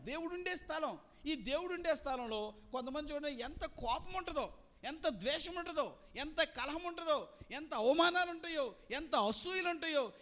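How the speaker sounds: usual speaking voice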